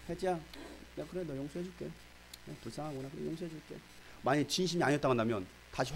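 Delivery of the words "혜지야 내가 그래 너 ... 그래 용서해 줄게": softer, grudging forgiveness; condescending quiet pardon. Pretend. Jesus voiced pardoning insincerely, softer and grudging. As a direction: forgive without meaning it.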